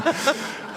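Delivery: a short, airy giggle; quick unvoiced chuckle; breathy laugh. Real, not acted. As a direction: let a laugh slip out mid-sentence. Laughs mid-sentence at own joke.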